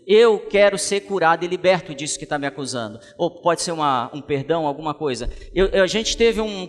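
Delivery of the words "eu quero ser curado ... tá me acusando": raised, ringing preacher's voice; full-voiced pulpit exhortation; fervent preaching. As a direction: preach it out to the church. Preaching on healing to congregation.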